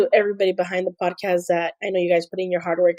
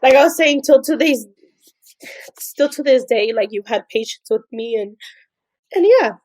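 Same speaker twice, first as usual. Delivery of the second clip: tearful thanks, higher and halting; crying; 3 semitones higher, 5 dB louder than usual. Real, not acted. Assistant thanking her boss for patience, tearfully. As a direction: fight through real tears to finish the thanks. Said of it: "I don't know why I'm crying".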